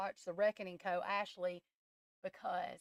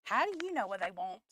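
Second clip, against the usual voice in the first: imitating doubters; 5 dB louder, faster than usual. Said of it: "people think I'm crazy".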